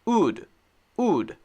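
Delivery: "ood" with no lip rounding, throat only; wrong W without lips. Shown as a demonstration. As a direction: say "ood" letting the throat make the sound, lips still. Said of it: "if I say it the wrong way".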